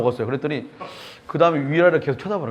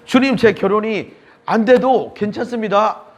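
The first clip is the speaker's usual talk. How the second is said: fervent prayer; 8 semitones higher, 5 dB louder, slower than usual. Retold or imitated. Retelling his surrender prayer.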